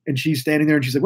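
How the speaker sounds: ordinary delivery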